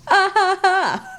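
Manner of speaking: hammed-up laugh at another guy's joke; exaggerated fake laughter. Wife laughing at another man's joke.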